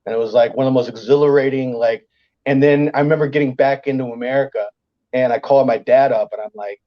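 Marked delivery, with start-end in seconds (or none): none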